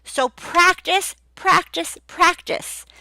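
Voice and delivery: slow and high, each practice stressed; repeated for emphasis. Shown as a demonstration. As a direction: hammer practice three times. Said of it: "That shows you weren't prepared".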